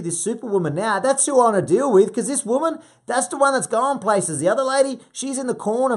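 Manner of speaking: high, loud coaching hype; fired-up pep talk. Acted. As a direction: drive it home to the newly empowered client. Acted coach pumping up Superwoman client.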